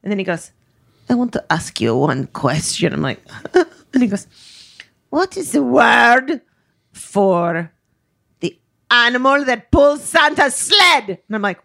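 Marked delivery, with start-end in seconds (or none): 1.1-2.9 put-on low, Dracula-like Greek accent; bad Greek accent. Greek driver cornering his nervous passenger, low bad Greek accent. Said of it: "I sound like Dracula". 5.1-11.1 put-on slow, halting build in a Dracula-like Greek accent; bad Greek accent. Greek driver asking passenger for a word, slow bad Greek accent. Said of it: "I can't do the accent".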